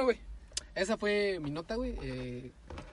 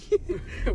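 high laugh; 11 semitones higher than usual. Real, not acted. Laughs at friend's excess.

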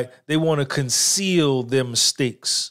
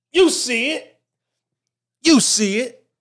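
excited show-off; 11 semitones higher, 4 dB louder than usual. Real, not acted. Showing off the shirt back.

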